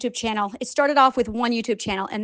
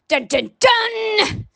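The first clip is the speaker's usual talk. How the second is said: drawn-out, higher mock suspense sting; dramatic sting; 8 semitones higher, much slower than usual. Teasing listeners before revealing the count, dramatic sting. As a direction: sing out a dun-dun-dun reveal.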